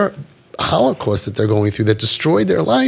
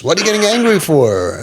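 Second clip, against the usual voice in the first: higher, exasperated mock scolding; untrained scolder; 5 semitones higher than usual. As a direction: imitate an indignant parent, stretching the question. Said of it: "the regular people who are not trained say".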